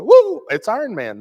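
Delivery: high, loud playground cheer; gleeful superhero shout; excited kid shout. Retold or imitated. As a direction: cheer like a boy spotting his hero. Boys playing superheroes.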